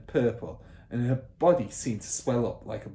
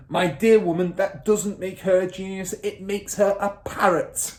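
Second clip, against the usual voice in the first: loud, higher condescending bellow; scornful shout; 9 semitones higher, 8 dB louder than usual. Acted. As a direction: sneer it loudly. Trunchbull voiced.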